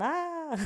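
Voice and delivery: high, drawn-out "aah" imitating her own excitement; high-energy squeal. Shown as a demonstration. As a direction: burst upward into an excited little cry. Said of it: "high energy and high up".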